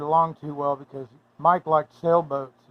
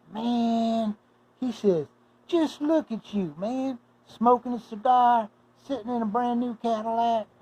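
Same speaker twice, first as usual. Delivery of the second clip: high, slow, impressed imitated voice; impressed admirer; 8 semitones higher than usual. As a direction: marvel at him, stretched out and pitched up. Classmate admires Mike in the Cadillac.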